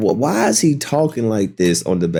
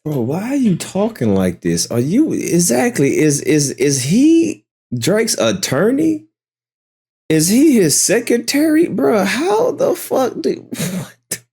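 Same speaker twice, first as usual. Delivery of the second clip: incredulous disbelief; 11 semitones higher than usual. Real, not acted. Incredulous streamer reacting to rant.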